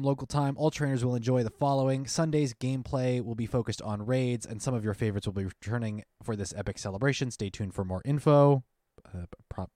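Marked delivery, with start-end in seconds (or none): none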